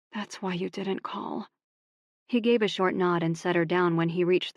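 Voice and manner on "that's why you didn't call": much softer, slow and gentle; soft realization. Acted. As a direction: say it gently, understanding. Quiet realization.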